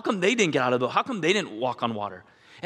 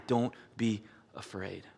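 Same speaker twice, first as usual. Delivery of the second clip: slow hushed whisper; 6 semitones lower, 10 dB quieter, slower than usual. Acted. Jesus speaking to the listener's heart, slow whisper. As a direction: breathe it softly like a comforting secret.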